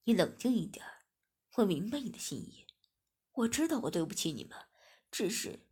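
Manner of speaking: a steady man calming her down. A narrator's reading. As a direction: soothe her gently in a man's voice. Master comforts crying girl.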